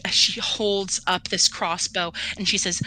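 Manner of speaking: ordinary talk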